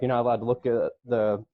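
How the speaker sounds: normal talking voice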